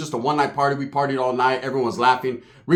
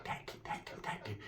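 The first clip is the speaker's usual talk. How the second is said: breathy unvoiced whisper; 25 dB quieter than usual. Cousin whispers back a plan about the girl.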